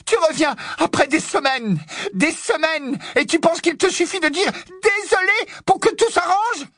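high, shrieked tirade; indignation furieuse. Acted. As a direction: rail at him in outrage. Hermione furieuse contre Ron. (tirade indignée, hurlée très haut)

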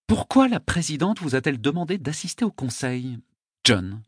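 high female interrogator; high voice, dropping on the name; Diana, questioning. A narrator's reading.

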